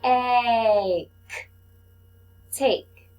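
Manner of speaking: "M-I-S" letter by letter, then "miss" blended; teacher sounding out the first syllable. Shown as a demonstration. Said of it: "Let's read our second syllable".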